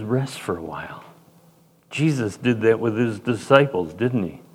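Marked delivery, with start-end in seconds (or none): 0.0-1.0 gentle invitation. Retold or imitated. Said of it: "He says".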